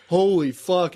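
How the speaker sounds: the whole team gasping at the big hit; loud amazed "Holy fuck"; stunned exclamation. Retold or imitated.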